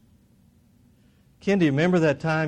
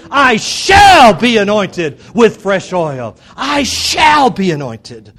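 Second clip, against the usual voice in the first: "shall" stretched long and shouted; stress on SHALL; 8 semitones higher, 18 dB louder, much slower than usual. Shown as a demonstration. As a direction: belt out "shall" high and long, slow declaration. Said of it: "emphasize the word "shall".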